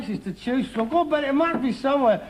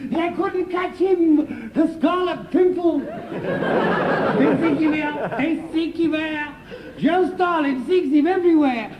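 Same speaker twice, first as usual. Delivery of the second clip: high, theatrical mock recital; mock-heroic; 6 semitones higher, slower than usual. Son recites father's supposed legend, mock-heroic. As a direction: declaim the legend high, drawing out the lines.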